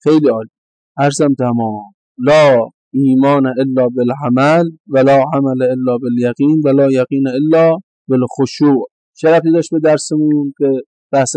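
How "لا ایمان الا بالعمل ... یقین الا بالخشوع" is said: slow Arabic recitation. Acted.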